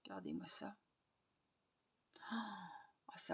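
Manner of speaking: awed soft whisper. Real, not acted. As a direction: breathe it in wonder.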